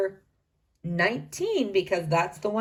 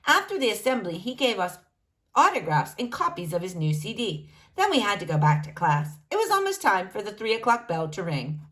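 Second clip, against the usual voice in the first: ordinary delivery, nothing marked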